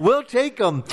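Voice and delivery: high burst of laughter; laughing. Real, not acted. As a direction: laugh while answering. Laughing about advertisers.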